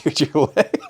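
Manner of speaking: high, squeezed words through laughter; laughing hard. Real, not acted. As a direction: barely get it out while cracking up. Cracking up recalling the injury.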